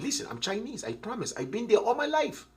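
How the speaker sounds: a light put-on Chinese accent; mock Chinese accent. Shown as a demonstration. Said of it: "and I even have the accent".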